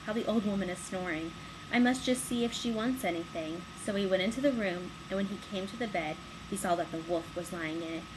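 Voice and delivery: plain reading voice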